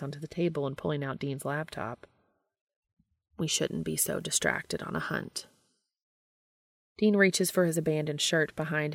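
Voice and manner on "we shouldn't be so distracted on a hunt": deep, level angel voice; low flat Castiel voice. A narrator's reading. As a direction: speak it low and even. Castiel voiced, much lower.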